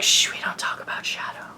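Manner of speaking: hushed mock whisper, all breath; imitated shushing whisper. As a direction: shush them like it's a secret. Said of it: "She was like".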